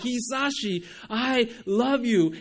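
loud, high, emphatic declaration of love. Retold or imitated. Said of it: "it wasn't this loud voice".

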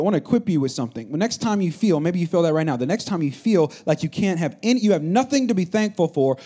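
ordinary talk, nothing marked

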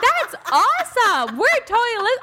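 gushing staff. Retold or imitated. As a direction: gush over it like a fan. Staff enthusing.

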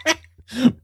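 giggling through the words; laughing. Real, not acted. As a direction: talk while still laughing. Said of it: "I can't stop laughing".

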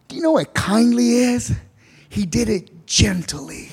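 gentle. Shown as a demonstration. Shows what kindly sounds like.

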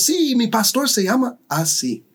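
high, puffed-up boast without real volume; proud mock shout. Pastors in the crowd boasting proudly, high mock shout. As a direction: brag about being the pastor. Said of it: "gritando, eh, orgullosos".

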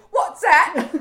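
high, loud British urchin cry; British orphan. Retold or imitated. British orphan on next cloud asking, cartoonish urchin voice. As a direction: pipe up like a British street orphan. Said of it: "the British orphan on the cloud next to me".

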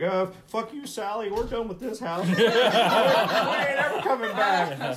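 high, loud mock panic breaking into laughter; freaked out. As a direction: bail out of the house in a laughing panic. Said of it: "they're all freaked the fuck out".

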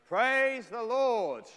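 high, loud and very slow, like a proclamation; slow proclaimed praise. Acted. Preacher proclaiming the psalm's opening to the congregation, slow and loud. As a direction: stretch out each word as if calling across a church.

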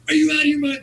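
squeaky high frantic voice of a flustered owner; panicked owner. Retold or imitated. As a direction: play the boss losing his mind in a shrill squeal. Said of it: "This guy's, like, losing it".

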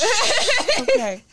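high laughing reassurance. Real, not acted. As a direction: giggle it out slowly.

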